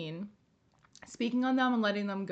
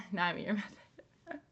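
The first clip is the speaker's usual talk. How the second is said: laughing; 8 semitones higher, 5 dB quieter than usual. Real, not acted. Laughs at her own slip.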